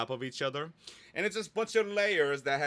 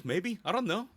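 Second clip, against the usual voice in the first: sung up higher, teasing, undecided; higher mock dithering; playful indecision; 9 semitones higher than usual. Real, not acted. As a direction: toss it off like a coy shrug. Teasing herself over adding a link.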